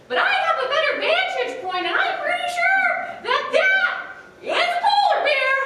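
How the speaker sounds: very high, loud bird voice, laughing through; high screechy albatross. A narrator's reading. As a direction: screech it with a giggle. Albatross voice in story.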